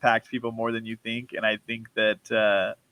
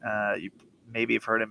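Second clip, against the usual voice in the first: ordinary delivery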